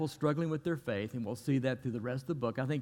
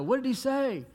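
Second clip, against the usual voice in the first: higher, louder call across the boat; yelling from the bow; 11 semitones higher, 4 dB louder than usual. Retold or imitated. Peter at the bow yelling to John, loud and high. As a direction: shout it over the wind. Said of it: "yelling out".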